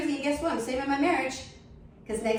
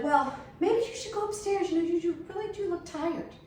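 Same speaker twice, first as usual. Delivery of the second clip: higher syrupy mock concern; fake sweet neighbour; 7 semitones higher than usual. Neighbour coaxing the wife off to bed, high sugary mock concern. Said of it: "And she was like".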